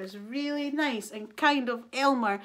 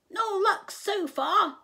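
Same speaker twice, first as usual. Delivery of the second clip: high, loud, slow bird voice; reporting birds; 9 semitones higher, 6 dB louder, slower than usual. A narrator's reading. Birds return to Elmer from searching, high-voiced. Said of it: "they said".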